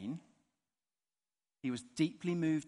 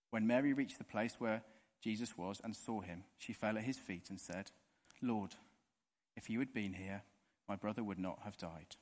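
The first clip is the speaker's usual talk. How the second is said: measured, steady Bible reading; scripture reading; 4 semitones lower, 4 dB quieter than usual. Acted. Preacher reading John to the congregation, steady scripture reading. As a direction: read the passage aloud to the congregation.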